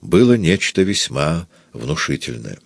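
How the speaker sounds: ordinary delivery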